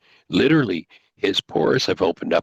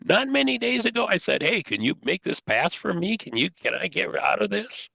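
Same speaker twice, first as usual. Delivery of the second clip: doubting Yeshua; 11 semitones higher than usual. Retold or imitated. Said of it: "Here's Yeshua going".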